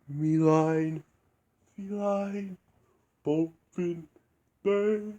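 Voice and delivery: the cat trailing off through nonsense rhymes; Cat rhyming. Retold or imitated. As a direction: reach for rhymes like a storybook cat. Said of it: "Cat would do the usual rhyming, like in the movie".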